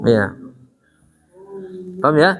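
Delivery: ordinary talk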